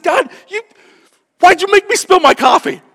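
high, loud, exasperated cry at God; mad at God. Retold or imitated. Pastor retelling a planter's outburst at God, exasperated high yell. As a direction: yelp it out like a frustrated man scolding God. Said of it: "He said he was just so mad".

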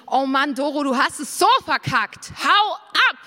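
imagined rebuke building to a shouted "Hau ab!"; hammed-up furious God figure shouting her away; harsh scolding. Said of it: "Und er sagt nicht".

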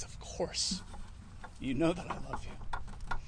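hushed, hurt and offended; a touch of wounded offence in a soft voice. Retold or imitated. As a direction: answer quietly, stung by the question. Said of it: "Peter's getting offended".

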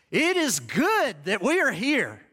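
high, slow, zealous declaration; eager Peter. Acted. Peter speaks to Jesus, high and eager. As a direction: say it slowly with lifted, delighted zeal. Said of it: "And Peter said to Jesus".

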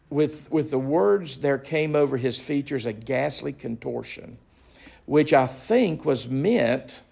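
ordinary delivery

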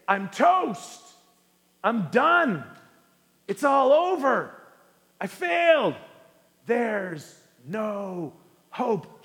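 wallow in hopelessness; hammed-up despairing sinner; mock despair.

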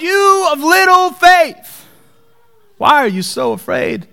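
high, slow, drawn-out rebuke; lightly voiced Jesus chiding the disciples; Jesus gently chiding. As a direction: stretch out the words of little faith. Voicing Jesus in the boat.

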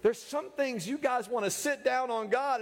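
normal talking voice

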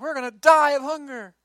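high, loud wailing moan of hungry people; whiny crowd complaint. Acted. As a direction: wail it like a melodramatic grumbler.

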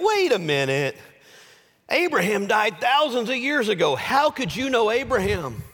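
high-pitched, challenging protest; incredulous objection. Acted. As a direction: challenge an impossible claim in disbelief. Pharisees' incredulous objection, acted.

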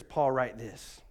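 low, soft divine command; Holy Spirit voice. Retold or imitated. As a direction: speak as the Holy Spirit, hushed and grave. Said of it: "And the Holy Spirit says".

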